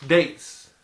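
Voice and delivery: loud delighted exclaim; excited exclaim. Real, not acted. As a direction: call out the word with delight. Loud exclaim over the dates.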